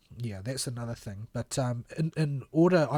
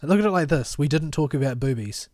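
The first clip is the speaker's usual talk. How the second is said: louder, teasing; cheeky; 3 semitones higher, 8 dB louder than usual. Real, not acted. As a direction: tease them, louder. Cheeky joke at the end.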